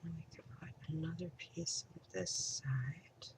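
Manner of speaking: soft whisper to herself. Real, not acted. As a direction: whisper while working. Crafting, muttering to herself.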